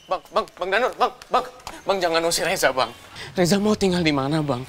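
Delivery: desperate pleading. Acted. Younger brother begging to stay, desperate. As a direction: beg your brother not to abandon you.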